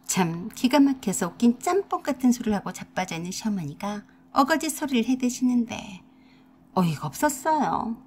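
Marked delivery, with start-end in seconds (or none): none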